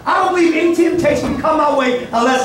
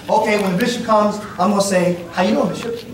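imitating a churchgoer; 6 semitones lower, faster than usual. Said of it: "you plan it all out".

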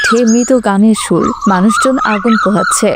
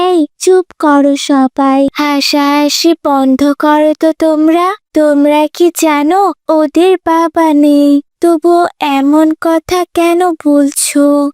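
slow, higher-pitched pleading rebuke; reproachful classmate; 5 semitones higher, slower than usual. Acted. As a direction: tell classmates off, drawing the words out. (টেনে টেনে সহপাঠীদের ভর্ৎসনা)

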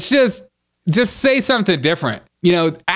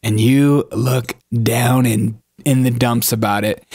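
normal talking voice

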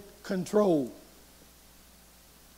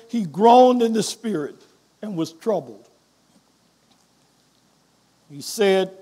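usual speaking voice

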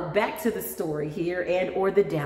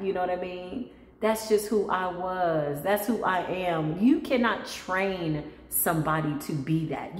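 usual speaking voice

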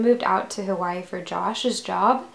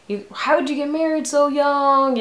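bad Southern accent; 7 semitones higher, 5 dB louder than usual. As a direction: imitate critics in a hammed-up Southern accent. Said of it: "doing this in a really shitty southern accent".

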